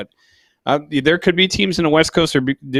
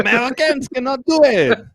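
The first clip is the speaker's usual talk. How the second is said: high, hammed-up French accent, dragging the words; a deliberately bad French accent for laughs; mock French accent; 12 semitones higher than usual. Podcast host pushed by co-host to try it, hammed-up French accent. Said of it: "someone do a French accent".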